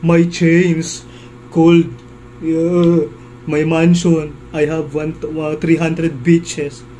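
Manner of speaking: mock rapper brag. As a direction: ham up a bragging rapper for a laugh. Mocking rappers who brag. (panggagaya sa mayabang na rapper, malakas)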